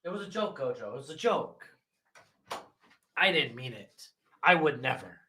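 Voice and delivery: hushed pleading to a pet; small, soft placating voice; soft apology. Pretend. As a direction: gently take back a joke. Soothing the dog after a joke.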